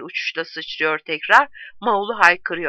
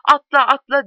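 high, loud urging; shouting; 6 semitones higher, 6 dB louder than usual. A narrator's reading. The whole wagon urging Tipo to jump, shouting. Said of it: "diye haykırıyor".

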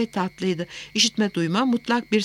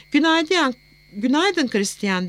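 cool, faintly hostile greeting; slightly hostile; 7 semitones higher, slower than usual. A narrator's reading. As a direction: greet him with a touch of hostility. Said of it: "derken sesi biraz düşmancaydı". (hafif düşmanca bir tonla)

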